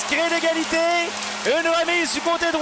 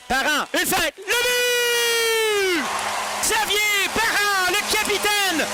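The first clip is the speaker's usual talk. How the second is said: high shouted goal call; 4 semitones higher, slower than usual. Real, not acted. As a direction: belt out the goal. Goal call in hockey play-by-play.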